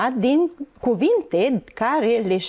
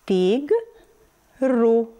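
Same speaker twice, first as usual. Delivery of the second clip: Tigru slowly in two beats, Tig, pause, ru; syllable-by-syllable Tigru for young readers; split into syllables. Shown as a demonstration. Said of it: "alcătuit din două".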